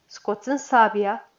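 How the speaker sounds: ordinary delivery, nothing marked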